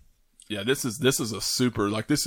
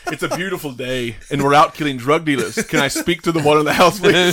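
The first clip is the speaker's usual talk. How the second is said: quoting through laughter, growing louder and higher; laughing movie quote; 5 semitones higher, 6 dB louder than usual. Retold or imitated. Acting out the drug dealer doorstep joke, laughing louder. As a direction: build the mock announcement until you crack up.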